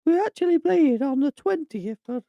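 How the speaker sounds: very high, loud comic squeak; silly squeaky voice. Pretend. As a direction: overcorrect into a loud, joke falsetto. Mocks own deep voice by overcorrecting.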